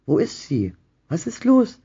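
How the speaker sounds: high, loud, startled questions; bewildered questioning. A narrator's reading. Husband startled awake by wife asks in loud confusion. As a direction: fire off confused questions, pitch jumping up. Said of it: "Verwirrt fragte er".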